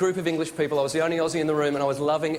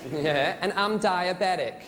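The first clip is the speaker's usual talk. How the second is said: very slow American drawl; American woman; much slower than usual. Acted. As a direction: imitate an American woman, stretched out. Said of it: "This American woman".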